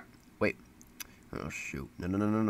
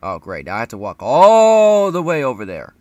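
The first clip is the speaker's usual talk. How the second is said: sarcastic; 3 semitones higher, 12 dB louder than usual. Real, not acted. Annoyed at long walk.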